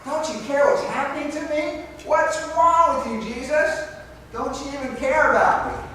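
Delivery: loud, high, exasperated cry to the Lord; frustrated outburst. Retold or imitated. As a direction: let the frustration burst out at God. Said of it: "when my frustration goes".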